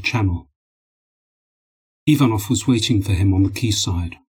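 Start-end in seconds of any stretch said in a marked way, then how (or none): none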